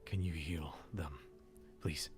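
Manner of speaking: soft, breathy whisper, fighting for calm; strained whisper. Acted. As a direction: whisper it, trying to center himself. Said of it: "breathing heavily".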